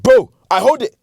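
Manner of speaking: loud, high pushy command; loud, high hammed-up older lad; mock shout. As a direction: shove the order at him. Guy handing him the spliff.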